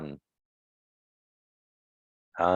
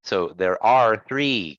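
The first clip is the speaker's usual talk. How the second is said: slow sentence with "are" punched; stress on ARE; slower than usual. Shown as a demonstration. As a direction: lean on "are" to mark the plural. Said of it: "Are is more than one".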